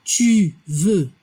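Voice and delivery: "tu veux" low, word by word, X silent; pronunciation model. Shown as a demonstration. Teacher showing learners the French form, careful low "tu veux" with silent X. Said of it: "La prononciación".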